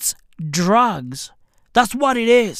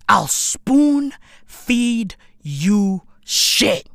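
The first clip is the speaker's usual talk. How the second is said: very slow drawn out; much slower than usual. Real, not acted. Drawn out promise.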